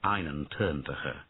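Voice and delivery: plain reading voice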